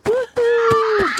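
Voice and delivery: very high, long joyful holler; thrilled whoop. Acted. Brother speeding down the icy street, high thrilled whoop. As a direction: whoop high as if flying downhill.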